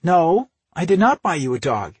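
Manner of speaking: high, loud, sugary denial; sweetly smiling. A narrator's reading. Sister innocently denying to her brother, sweet. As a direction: deny it with a sweet smile. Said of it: "she said with a sweet smile".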